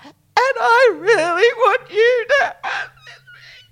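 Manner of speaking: real tears, high and breaking; crying. Real, not acted. Friend retelling giving away her ring, crying for real. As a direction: speak through real crying, slowly.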